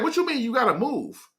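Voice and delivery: high-pitched baffled question; puzzled disbelief. Retold or imitated. Questioning Dave about always moving, puzzled. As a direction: press him, baffled.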